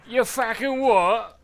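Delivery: loud, high, drawn-out mock Cockney; exaggerated Cockney accent. Shown as a demonstration. Showing which accent he means, exaggerated Cockney yell. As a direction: yell it in over-the-top Cockney. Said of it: "It's like the super exaggerated London".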